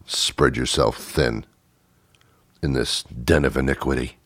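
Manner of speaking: low, soft, halting mock lament; hurt pleading. Jealous diner pleading for Barbara's love and attention, hurt. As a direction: sink into a wounded, lovelorn murmur.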